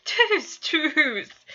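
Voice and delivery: high, loud shout through laughter; laughing call. A narrator's reading. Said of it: "called out as he managed to get a hold of himself".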